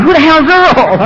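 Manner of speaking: puzzled crowd. Retold or imitated. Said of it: "The whole meeting's going".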